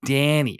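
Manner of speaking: DANNY with a broad, stretched American A; really Americanize the A in DANNY. Shown as a demonstration. Irish host giving their name at Starbucks, exaggerated American A in DANNY. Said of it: "really American the A".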